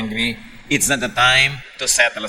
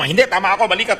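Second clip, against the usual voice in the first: higher and louder, stubbornly insisting she is right; insistent angry sister; 7 semitones higher, 3 dB louder, faster than usual. Retold or imitated. Older sister arguing with her younger sibling, higher, louder mock anger. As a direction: play the angry older sister who won't back down.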